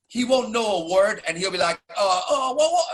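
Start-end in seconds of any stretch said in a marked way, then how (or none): 2.0-2.8 a rising, higher-pitched "whoa" of wonder; a lightly done gentle monk voice, climbing up; imitating the Dalai Lama. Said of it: "I can't do his voice".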